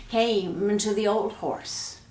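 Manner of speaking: usual speaking voice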